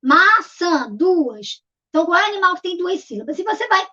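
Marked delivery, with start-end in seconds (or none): 0.0-0.9 MAÇÃ split into two beats, MA, ÇÃ; syllable by syllable. Shown as a demonstration. Teacher answers how many syllables, syllable by syllable. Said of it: "Quantas sílabas tem a maçã?".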